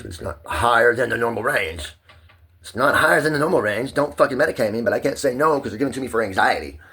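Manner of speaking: high, loud protest building to a shout; worked-up, high-pitched yelling; real angry protest. Real, not acted. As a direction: shout it insistently, pitch shooting up. Angrily protesting being medicated.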